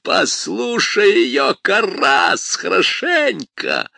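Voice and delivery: loud, high screaming demon; demon shouting. Acted. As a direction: scream it at him. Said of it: "Бес закричал".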